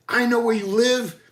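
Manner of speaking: a hint of the furious dog owner; imitating the angry woman. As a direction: play the screaming neighbor lightly. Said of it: "her exact words".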